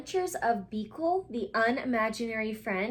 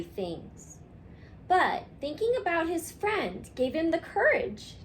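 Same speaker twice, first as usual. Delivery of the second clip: storybook reading; 2 semitones higher than usual. A narrator's reading. Teacher reading a picture book to her class, gently. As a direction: read it like bedtime to little ones.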